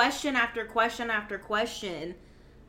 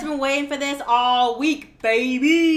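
excited; 6 semitones higher, 13 dB louder than usual. Real, not acted. Excited for the main topic.

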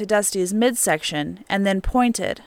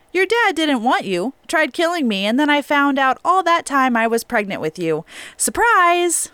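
higher, bitterly bright mock cheer; imagine telling the child, hammed-up and sarcastic; a lightly voiced, sarcastic surprise reveal; mock blunt announcement; 6 semitones higher than usual. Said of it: "she couldn't say it that way".

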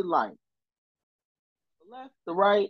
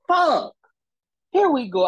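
higher, louder retold curse; exasperated curse; 13 semitones higher, 6 dB louder than usual. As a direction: blurt it out, fed up. Ticket confirmed by the officer.

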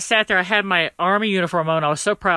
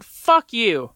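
retold angry shout; defiant retort; 4 semitones higher than usual. Retold defiant outburst at counselor.

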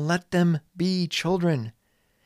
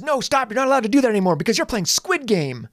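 fast, high, loud scolding; scolding playground monitor; 7 semitones higher, 5 dB louder, faster than usual. Retold or imitated. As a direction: bark the rules at the kids like a flustered monitor. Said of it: "the playground monitor says".

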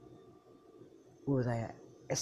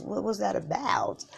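voiceless hushed whisper; 2 semitones higher, 6 dB quieter than usual. Pretend. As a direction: whisper it to your partner. Unvoiced whisper.

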